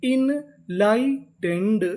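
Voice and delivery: Enlightened stretched very slowly, syllable by syllable; drawn-out demo. Shown as a demonstration. Teacher slowly sounding out the whole word for students. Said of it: "तो पूरी meaning".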